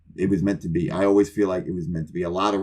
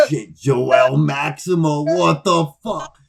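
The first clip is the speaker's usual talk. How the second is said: stunned disbelief; 7 semitones higher, 5 dB louder, much slower than usual. Retold or imitated. Stunned reaction to famous name.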